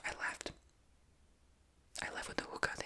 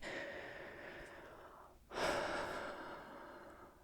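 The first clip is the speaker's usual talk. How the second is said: long breathy sigh, no voice. Real, not acted. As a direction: let out a long, drawn-out sigh. Exasperated sigh in whispered story.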